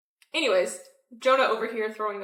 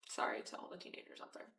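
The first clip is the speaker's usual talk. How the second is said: whispered aside; 14 dB quieter than usual. Real, not acted. Quick apologetic aside.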